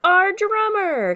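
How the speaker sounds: high, loud, stretched-out cry; thrilled storybook call-out; captain's delighted exclamation. A narrator's reading. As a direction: exclaim it as the answer arrives. Captain greets the missing drummer.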